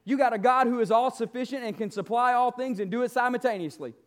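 ordinary talk, nothing marked

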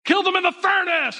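high-pitched acted royal outburst; mock enraged command. King orders the youths into the furnace, mock enraged. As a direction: hand down a death order in a mock fury.

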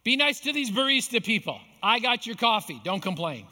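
high, loud call across to a friend; calling out to Bob in the drive-thru; yelling out the window. Retold or imitated. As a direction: shout it over to the next car. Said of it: "So I yell out my window".

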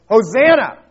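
full exclamation, loud and high; shout. Shown as a demonstration. Preacher demonstrating how Hosanna is always said, shouted. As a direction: shout it out like the crowd. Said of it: "very essence of the word is a shout".

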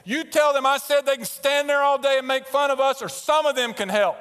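loud, high, forceful orders; retold angry yelling. Mission leader ordering the translator before jeering prisoners, reenacted angry yell. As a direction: bellow so the whole crowd hears. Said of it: "I'm talking just like this".

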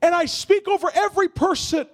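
rising prophetic prayer, high and loud, shouting at the peak; preaching at full force. Real, not acted. Pastor prophesying over the congregation, building to a shout.

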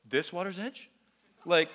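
taunting question. Retold or imitated. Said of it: "they were like".

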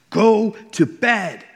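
high, loud, slow furious yell; screamed at daughter. Retold or imitated. Exhausted dad losing it at his daughter at 3am, furious shout. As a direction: blow up and bellow each word. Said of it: "I, like, screamed at her".

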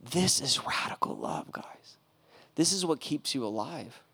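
recalling the homeless man, whispered; hushed whisper. Real, not acted. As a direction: whisper it in awe. Hushed climax.

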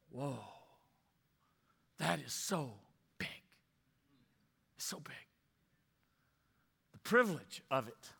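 quiet wonder at something huge; hushed awe. Real, not acted. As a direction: let the wonder drop your voice. Awed hush over the temple idea.

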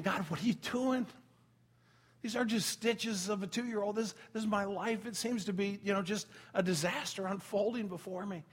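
ordinary talk

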